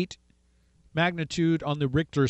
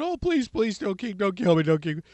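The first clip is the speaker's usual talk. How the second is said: panicked pleading; 2 semitones higher than usual. Retold or imitated. Haman begs the king to spare him, panicked pleading. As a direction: plead for your life, falling apart. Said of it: "Haman's all in a panic".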